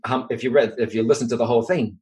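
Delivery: ordinary talk, nothing marked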